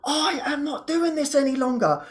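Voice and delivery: high, emphatic refusal; retold firm resolve. Snapping out of the victim story, high and emphatic resolve. Retells resolve to stop wallowing.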